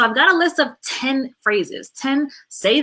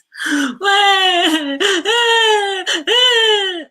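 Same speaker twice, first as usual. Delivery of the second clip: loud, high wailing cry, drawn out in waves; big fake bawling; imitated child crying; 10 semitones higher, 8 dB louder than usual. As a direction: wail like an upset kid, mock full force. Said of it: "and they start crying".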